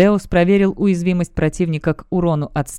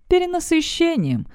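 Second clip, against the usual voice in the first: reproachful; 11 semitones higher than usual. Acted. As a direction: scold them with one word. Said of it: "укоризненно произнесла Фурия". (укоризненно)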